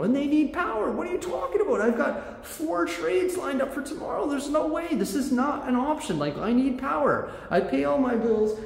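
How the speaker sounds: high, strained, disbelieving rant at the rep; replaying the call as a high-pitched outraged protest; exasperated rant. Retold angry call to power company.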